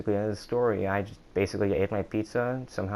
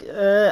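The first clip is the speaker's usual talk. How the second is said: high, loud monotone groan; Tina groan; 10 semitones higher, 7 dB louder than usual. Retold or imitated. Kid groaning like Tina from Bob's Burgers, high and loud. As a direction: drone out a flat uh like a cartoon teen. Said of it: "literally Tina from, like, Bob's Burgers".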